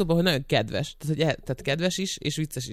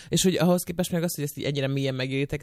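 usual speaking voice

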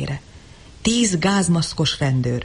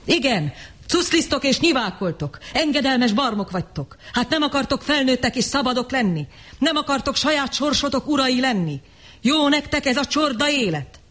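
higher, scornful harangue building up; insulting tirade; 7 semitones higher than usual. A narrator's reading. The Savage insulting the Deltas, scornful and rising. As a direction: fling insults at the herd, rising. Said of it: "A durva sértések".

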